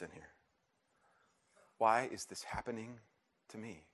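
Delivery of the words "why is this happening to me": pained question. Acted. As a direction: ask it wearily, trailing off softly. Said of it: "all of us ask in pain".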